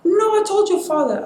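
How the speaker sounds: high-pitched mock mom reply; imitating mom. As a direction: voice mom breaking the news, pitched well up. Mom's reply voiced in the story.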